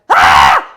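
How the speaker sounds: short loud scream of panic; panicked scream. Retold or imitated. Trapped on the bus, reliving the moment, loud panicked scream. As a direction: let out one sudden loud scream. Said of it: "surface level I was like".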